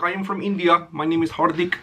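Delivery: lightly imitating the boss's Indian accent; mock Indian accent. Comic imitating the Indian boss introducing himself, light Indian accent. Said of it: "he did have a strong accent".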